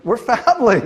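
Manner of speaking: high, loud and laughing; laughing announcement. Retold or imitated. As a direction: declare it with delighted disbelief. Announcing the family link laughingly.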